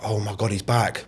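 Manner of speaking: hushed imitated panic; hushed dread. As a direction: mutter it like you're dreading the door. Dread at second knock.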